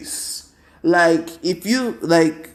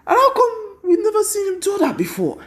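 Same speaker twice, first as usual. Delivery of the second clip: amazed. Retold or imitated. Crowd amazed.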